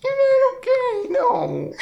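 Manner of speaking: high-pitched laugh through words; laughing so hard the words squeak. Real, not acted. Laughing fit over joke.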